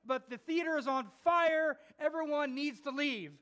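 loud, high-pitched urgent announcement; alarmed clown. Retold or imitated. Clown interrupts the show to warn the theater, loud urgent alarm. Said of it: "the clown interrupted the thing and said".

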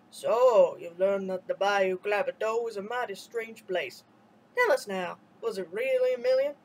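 low, lightly voiced elder; elder quizzing the boy gently; old man's voice. A narrator's reading. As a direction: ask knowingly, like an old storyteller.

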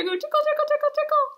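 high squeaky tickle tickle; playful tickle. Pretend. Teacher tickles the sheep's nose, squeaky high. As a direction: lift the voice way up and tease.

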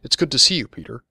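high, loud opening on the greeting; eager greeting. A narrator's reading. Woman greeting Peter over someone's mutterings, eager.

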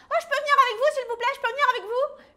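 very high, loud, fast chick voice; little chick begging. Acted. The tiny chick begs the warrior chickens to come along. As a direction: beg like an eager tiny chick. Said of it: "Un petit poussin les voit et crie".

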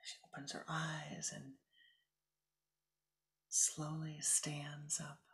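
slow, hushed guided-story whisper. A narrator's reading. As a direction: breathe the scene out in a whisper. Whispered meditation story narration.

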